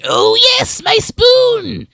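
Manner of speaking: high, loud delighted creature shriek; excited lizard man. Acted. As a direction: cry out like a thrilled lizard creature. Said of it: "The lizard man whirls around and says".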